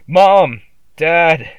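full-throated, very high, very loud howl; a terrified child howls for help, very high and very loud. A narrator's reading. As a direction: scream for your parents with everything. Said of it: "I howled".

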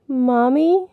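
put-on high, tentative child's question; quiet little boy. A narrator's reading. Billy answering from his hiding place, quiet. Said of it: "Billy asked quietly".

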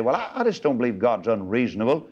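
fast, breezy mock of careless people; lightly mocking quick rattle; mock believer. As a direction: rattle it off like a smug skeptic. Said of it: "People say today".

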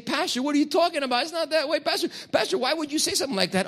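scandalized churchgoers; mock congregation objection. Congregation to pastor, high fast mock objection.

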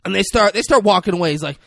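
ordinary delivery

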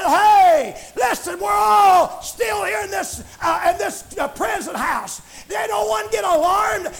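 high, urgent preacher's shout as Paul; crying out. Acted. Paul calling to the jailer that all are here, crying out. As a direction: call out loudly across a prison. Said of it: "Paul cried out, said".

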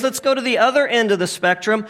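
ordinary talk, nothing marked

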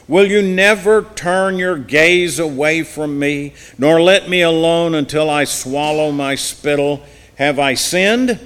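impassioned Bible reading; forceful scripture reading. Acted. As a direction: read it loud and pleading, preacher style.

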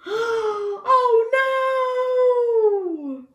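high, loud, drawn-out dismay; mock horror. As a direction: gasp and wail in pretend alarm. Mock shock for listening children.

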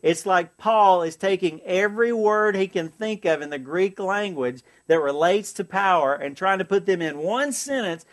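ordinary talk

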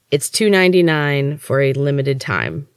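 plain reading voice

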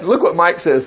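ordinary talk, nothing marked

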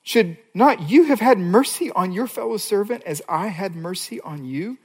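a hint of a stern master rebuking; angry master. The master rebuking the unforgiving servant, lightly voiced. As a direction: scold the servant from on high. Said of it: "his master summoned him and said".

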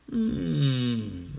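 ordinary delivery

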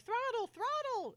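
high, loud, slow urgent calls; urgent commands. Retold or imitated. Lee urging Amanda to climb, high loud urgency. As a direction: call it out urgently. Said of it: "he's like".

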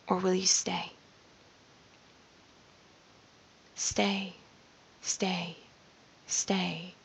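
soft whispered urging to stay and breathe, fading away; hushed whisper. Shown as a demonstration. Speaker gently coaxing listener to stay still, whispering. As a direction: breathe the words out almost silently.

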